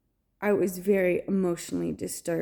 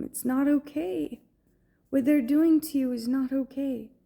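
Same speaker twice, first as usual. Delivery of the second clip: retold crying out; 5 semitones higher than usual. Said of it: "I'm crying out his name".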